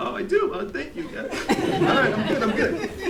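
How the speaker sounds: high, laughing through tears; embarrassed, emotional giggle; teary laughing. Real, not acted. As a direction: laugh it off while choked up. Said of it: "just like right now".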